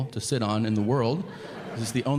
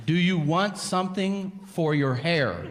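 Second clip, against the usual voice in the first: 1 slow repeating doctor; 6 semitones higher, slower than usual. Retold or imitated.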